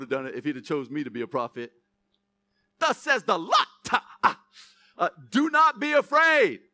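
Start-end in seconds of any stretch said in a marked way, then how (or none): 2.8-6.6 loud, high, fervent preacher's call; full-force preaching. Acted. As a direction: drive it home to the congregation.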